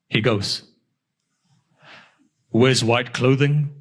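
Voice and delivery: ordinary talk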